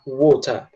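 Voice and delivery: British "water" with a clear T; "water" ending in an open "tah"; British true T. Shown as a demonstration. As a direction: say "water" with a real T, final R dropped. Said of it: "como se fosse um T mesmo".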